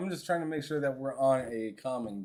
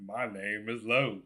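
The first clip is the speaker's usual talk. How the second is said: mock Texan accent; 5 semitones lower, 4 dB quieter than usual. Teasing a friend about his accent, mock Texan voice. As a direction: do your friend as a Texan, pitched down. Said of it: "You cannot impersonate me with that voice".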